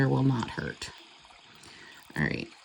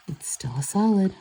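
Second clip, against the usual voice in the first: whispering; 4 semitones higher than usual. Real, not acted. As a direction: whisper it to yourself. Mock scolding the polish.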